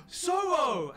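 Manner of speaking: high, loud cry of recognition; startled, joyful yell of a name; astonished shout. Acted. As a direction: call out to a lost child. Camp cries out at lost child.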